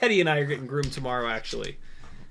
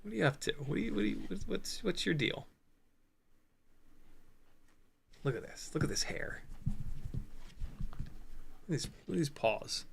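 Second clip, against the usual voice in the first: much softer, near whisper; hushed dog talk; 13 dB quieter, faster than usual. Real, not acted. Cooing softly over his dog's hair and paws. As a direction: murmur to the dog so as not to wake him.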